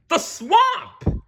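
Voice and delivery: very high, loud shriek; terrified cry. A narrator's reading. Kids recognizing the dreaded teacher, a high panicked shriek. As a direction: shriek her name in panic. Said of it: "cried the kids".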